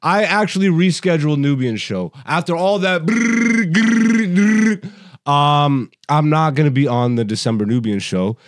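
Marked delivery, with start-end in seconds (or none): 3.1-5.3 high rolled brrr and grrr; trilled noise. Retold or imitated. Host mocks all his earlier announcements, trilled noise. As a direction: roll out long trilled blurts.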